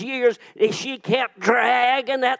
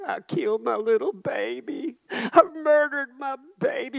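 imitating a tearful lament, higher; mock sobbing confession; whispering grief; 5 semitones higher than usual. Pastor voicing women in recovery room, tearful. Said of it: "I could hear 'em whispering".

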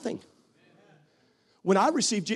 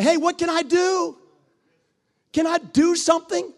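much higher and louder, bright and eager; eager volunteer; 14 semitones higher, 7 dB louder than usual. Retold or imitated. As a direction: sound like someone itching to pitch in. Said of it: "the people that say".

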